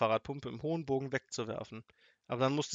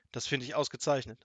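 usual speaking voice

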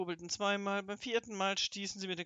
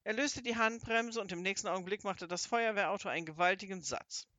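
usual speaking voice

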